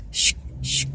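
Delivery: quick whooshing 슉 sounds; imitated qigong noise. Imitating the auntie's strange massage noises, quick whooshes. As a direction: mimic the masseuse's odd whoosh. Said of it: "이런 소리 막 내는 거예요".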